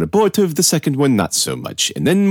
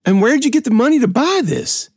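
cringing suspicion. A narrator's reading. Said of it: "He cringed at my clothes".

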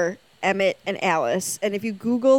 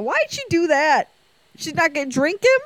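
mock bewilderment; 7 semitones higher, 4 dB louder than usual. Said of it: "And I went".

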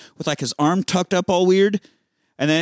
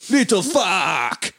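slow stretched yell, higher; mock scream; 8 semitones higher, slower than usual. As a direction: ham up a mob boss screaming. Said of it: "And he screams".

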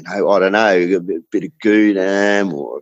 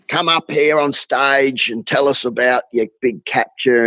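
higher-pitched mock stage host; imitating event host; 5 semitones higher than usual. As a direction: call him up like a host. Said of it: "the host, called him up".

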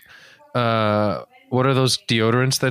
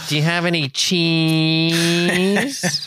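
spooky villain voice; 5 semitones higher, much slower than usual. Acted. Slow put-on voice for show bit.

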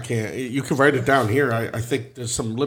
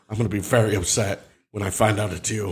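ordinary talk, nothing marked

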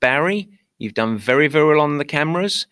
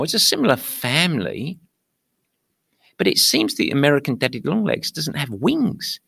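ordinary talk

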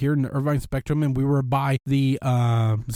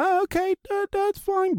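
mock desperate agreement. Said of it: "desperate people be like".

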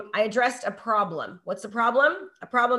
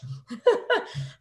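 high laugh; 11 semitones higher than usual. Real, not acted. Coach laughing at yawning student.

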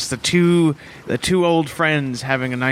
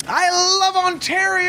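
yelled out; 13 semitones higher than usual. Acted. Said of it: "Try yelling something out at the lake".

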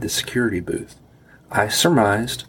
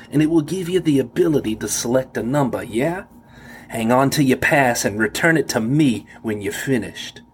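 put-on New York guard accent, higher, rising yeahs; 4 semitones higher than usual. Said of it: "I could recognize his New York accent now".

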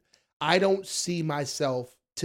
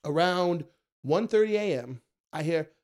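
ordinary delivery

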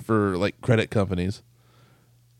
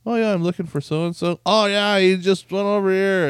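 mock chirpy reply; 10 semitones higher, 5 dB louder than usual. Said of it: "they're like".

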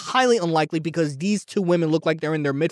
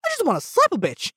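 high, fast imitation of his friend; lightly voiced exasperated friend; fast high mock friend voice; imitating best friend; 13 semitones higher, faster than usual. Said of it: "my best friend would come out of nowhere and be like".

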